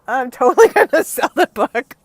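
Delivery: amused declaration cracked up by laughing. Real, not acted. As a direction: talk through a burst of gleeful laughter. Laughing at finding ex's book.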